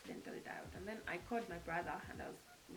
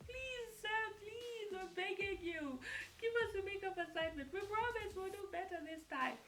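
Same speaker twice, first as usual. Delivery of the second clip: mock begging; 11 semitones higher than usual. Students begging lecturer for a makeup, higher whiny pleading. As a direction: beg like a desperate student. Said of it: "we continue begging him".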